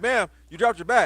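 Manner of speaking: loud, slow call to a stranger. Retold or imitated. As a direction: call after her politely. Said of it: "he's like".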